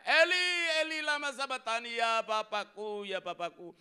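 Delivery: loud, high anguished cry; Jesus on the cross, forsaken, anguished. Acted. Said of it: "dia teriak".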